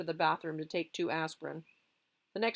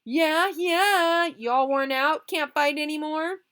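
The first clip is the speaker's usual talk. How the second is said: high, loud jeer; mock the worn-out losers; 10 semitones higher, 10 dB louder, slower than usual. Boys jeering at beaten rivals.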